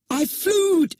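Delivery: high, thrilled cry of joy; screamed. A narrator's reading. Michael cries out while flying, shrieking with joy. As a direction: shriek it out mid-flight. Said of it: "he screamed while still in midair".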